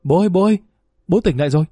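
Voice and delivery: calling out to father. A narrator's reading. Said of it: "đều đồng thanh gọi". (giọng trẻ gọi bố, hơi mừng rỡ)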